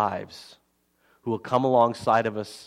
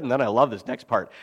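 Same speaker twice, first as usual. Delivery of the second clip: usual speaking voice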